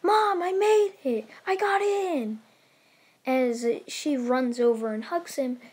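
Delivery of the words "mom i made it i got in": high, loud, jubilant shout; thrilled calling out; excited yell. Acted. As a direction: yell the good news to mom. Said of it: "slams it open, and yells".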